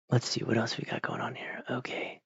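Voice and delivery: soft breathy whisper to himself. Real, not acted. Streamer checking his screen, whispering to himself. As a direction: drop to a whisper while browsing. Muttering to himself while browsing.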